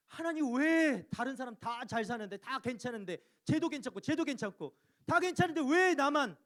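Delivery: loud, high lament, building to a shout; impassioned preacher's outcry; crying out to God. Acted. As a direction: wail your complaint up to heaven. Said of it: "금방 이렇게 부르짖으니까".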